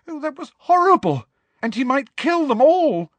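very high, loud distress; quaking horror. A narrator's reading. Girl aghast after the giant's rampage, quaking and distressed. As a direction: cry it out high, horrified. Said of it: "quaking so badly".

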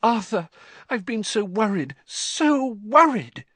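high-pitched anxious wife, overwrought; a distraught woman fussing in relief; frantic worry. A narrator's reading. Frantic wife greets returning husband.

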